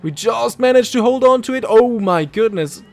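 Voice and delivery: real relief after a save; relieved excitement. Real, not acted. Saves the car.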